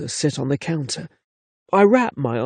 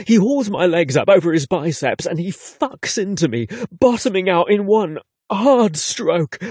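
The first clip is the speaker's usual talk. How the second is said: ordinary delivery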